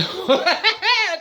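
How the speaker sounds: high, jittery laugh-like squeal; high shuddering cackle; high vocal outburst. Shown as a demonstration. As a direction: let out a sudden high stuttering noise. Noise bursting out when uncomfortable.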